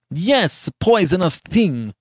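high, drawn-out cry of loathing; furious passion. A narrator's reading. Giovanni repeating his insult to Beatrice, beside himself. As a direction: hurl the insult, beside yourself. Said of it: "beside himself with passion".

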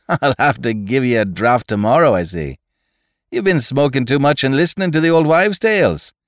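laughing Irish doctor's scoff; ringing laugh. A narrator's reading. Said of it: "He broke into a ringing laugh".

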